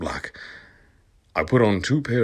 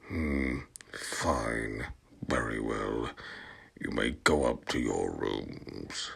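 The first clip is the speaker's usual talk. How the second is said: much lower and softer, drawn-out and languid; Snape voice; 9 semitones lower, 12 dB quieter, slower than usual. Acted. Snape dismissing the couple, low soft drawl. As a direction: purr it like a weary potions master. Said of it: "Professor Snape said".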